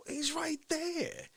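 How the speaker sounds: high, soft, very slow insistence; Dick Fauci. Retold or imitated. Fauci insisting the invisible friend is there, Fauci impression. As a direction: stretch it out, hushed and affronted. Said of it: "He's like".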